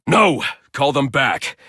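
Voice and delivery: loud, high command to stop the men; urgent shout. Acted. Vigilante yelling at police to call their men back, loud urgent command. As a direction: bark the order before it's too late. Urgent order to recall officers.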